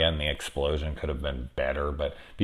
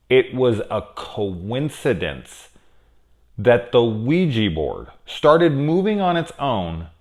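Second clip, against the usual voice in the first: slow, higher-pitched mock incredulity, spaced out; sarcastic disbelief; 5 semitones higher, 3 dB louder than usual. Reviewer scoffing at the kids' coincidence excuse, sarcastic disbelief. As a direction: spell out the absurdity in drawn-out disbelief. Mocking characters' absurd excuse.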